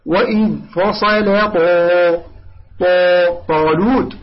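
slow recitation, dark rounded "Taw" in طالوت; measured tajweed demo of heavy طالوت; correct heavy Ta. Shown as a demonstration. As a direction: stretch طا full and heavy. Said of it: "لا تقول طالوت".

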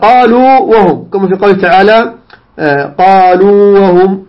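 قالوا held very long on uu before وهم; model recitation. Shown as a demonstration. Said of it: "هكذا".

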